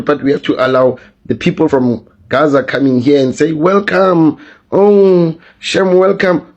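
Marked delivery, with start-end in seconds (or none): 3.5-6.5 sarcastic, sing-song welcome; a mildly hammed-up sarcastic welcome; mock welcome. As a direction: put on a sugary mock greeting. Mocking the welcome for Gaza arrivals.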